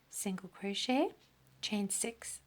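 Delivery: usual speaking voice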